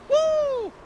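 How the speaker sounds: high, loud whoop; excited whoop. Real, not acted. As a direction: let out a wild cheer. Wreck replay excitement.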